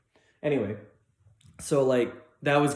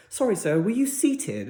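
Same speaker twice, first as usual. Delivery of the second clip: high, louder British lady impression; English waitress; 9 semitones higher, 5 dB louder than usual. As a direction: imitate a British hostess checking on a guest. English restaurant lady voiced.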